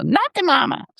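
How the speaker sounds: put-on high, bratty baby voice; baby dinosaur voice. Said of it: "what the baby says".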